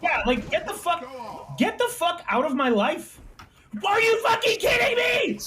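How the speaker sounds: rising high furious shout; building gamer rage, shouting; raging gamer. Real, not acted. Raging at opponent in game.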